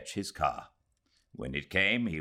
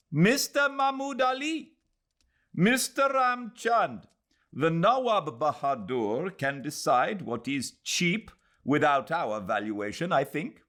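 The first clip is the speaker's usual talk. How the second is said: higher, deliberate voice of a stiff, formal man; stiff formal gentleman; 12 semitones higher, 4 dB louder than usual. Acted. Mahmud Ali correcting Mister Ramchand before the gathering, stiff and high. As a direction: lecture the room, stiff and proper, from a raised pitch.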